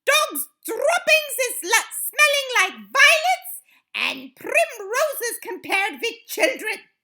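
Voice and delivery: high, loud witch rant; screeched. A narrator's reading. Grand High Witch ranting about children, screeched. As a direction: rant it shrieking to the hall. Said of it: "screeched the Grand High Witch".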